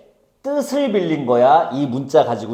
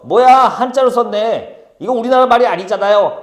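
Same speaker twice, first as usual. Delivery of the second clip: lightly acted, higher, louder objection; mock protest; 7 semitones higher, 4 dB louder than usual. Teacher imitating someone misreading hyangchal, mock indignant protest. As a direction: object like a clueless reader, raised voice. Voices ignorant reader seeing hyangchal.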